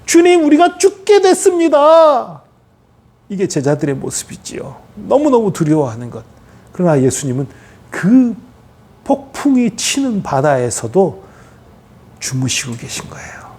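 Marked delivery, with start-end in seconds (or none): 0.1-2.4 high, loud, drawn-out cry of panic; acted desperate wail to the master; terrified disciples. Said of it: "제자들은 너무너무 두려워해요". 12.2-13.5 very soft, low, near-whisper; soft, lowered preaching voice; hushed calm. Real, not acted. Describing calm sleep amid storm.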